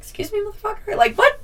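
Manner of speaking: can't believe what she just heard; outraged disbelief. Real, not acted. Teen girl hearing she's called fat, outraged disbelief. Outraged reaction to rude remark.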